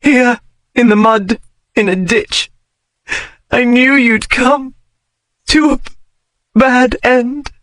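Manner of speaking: high despairing lament. A narrator's reading. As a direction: let the voice break on bad.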